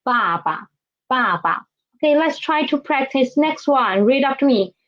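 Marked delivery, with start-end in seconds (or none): 0.0-1.7 爸爸 with falling first 爸, low light second; teacher's model 爸爸, second 爸 lower and unstressed; second syllable neutral. Shown as a demonstration. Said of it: "it will be lower".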